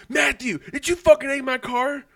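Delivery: high, loud mock outrage; mock accusing demand. Imitating Michaela calling about the egged car, high accusing demand.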